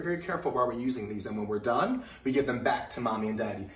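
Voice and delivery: ordinary talk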